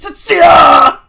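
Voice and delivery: very high, loud karate cry; full-throated mock martial-arts shout; Tommy from Power Rangers. Reader showing how she should attack, karate battle cry. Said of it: "the Tommy, Tommy from Power Rangers".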